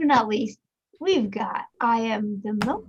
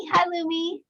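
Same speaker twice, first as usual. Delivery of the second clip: usual speaking voice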